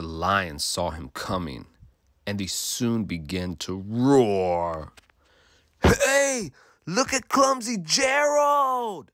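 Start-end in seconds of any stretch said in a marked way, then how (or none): 5.8-9.1 high, mocking jeer; sneering. A narrator's reading. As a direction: jeer at the clumsy one, pitched way up. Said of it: "the animals all sneered".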